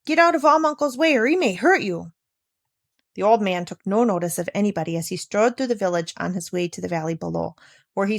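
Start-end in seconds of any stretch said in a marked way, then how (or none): 0.0-2.1 higher warning call; calling to children. A narrator's reading. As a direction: call out a warning to the little ones. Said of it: "would call to their little ones".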